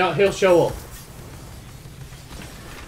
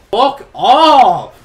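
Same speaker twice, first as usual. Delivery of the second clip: much louder, higher furious yell at the game; raging shout; 8 semitones higher, 13 dB louder than usual. Real, not acted. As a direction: blast it out in pure frustration.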